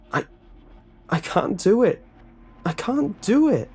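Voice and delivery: very high, loud panicked crying out; terrified victim at the breaking point; desperate pleading. A narrator's reading. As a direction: break down in terror, rising each time. Captive breaking down.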